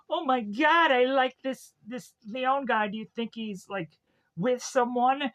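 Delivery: high, gushing imitation of smitten women; imitated swooning women. Women asking him about Leon, mock gushing.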